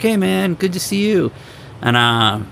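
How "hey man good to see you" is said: lifted, high and upbeat; high friendly greeting. Retold or imitated. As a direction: greet him brightly, up high. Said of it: "And I was like".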